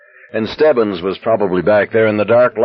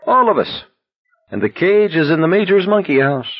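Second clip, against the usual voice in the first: high, amused delight; genuine pleasure; 10 semitones higher than usual. A narrator's reading. Garrity joking to McVrees about the cage, delighted. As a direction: lift it high, tickled by the joke. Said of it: "Garrity laughed with genuine pleasure".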